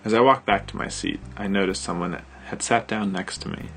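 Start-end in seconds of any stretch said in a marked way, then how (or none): none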